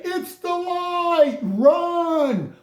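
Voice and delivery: high, slow panicked cry of a bug; panicked roach. Retold or imitated. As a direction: wail it like a fleeing roach.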